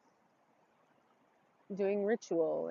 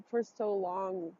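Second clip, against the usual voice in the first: ordinary delivery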